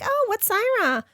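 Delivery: high puzzled question. Retold or imitated. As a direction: ask it high and confused. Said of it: "I'm like".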